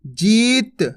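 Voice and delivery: usual speaking voice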